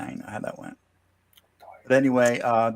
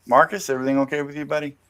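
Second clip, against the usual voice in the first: usual speaking voice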